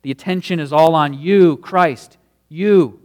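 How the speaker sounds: you rises high and loud, Christ and you hammered; emphatic climax on you; stress on you. Shown as a demonstration. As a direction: build to a ringing you. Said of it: "It's emphatic".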